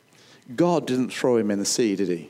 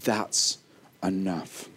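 ordinary delivery

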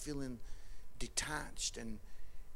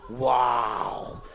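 loud, mock-admiring sarcasm; sarcastic; 4 semitones lower, 9 dB louder, slower than usual. Dad reacting to his daughter's curt text, sarcastic. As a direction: play up the fake admiration, loudly.

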